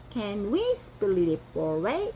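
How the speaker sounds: Can we split it four way drawn out very slowly, rising at the end; slow model question to the waiter about a four-way split; very slow demo. Shown as a demonstration. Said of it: "이렇게 하시면 돼요".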